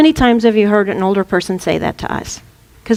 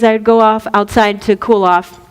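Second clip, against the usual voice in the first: usual speaking voice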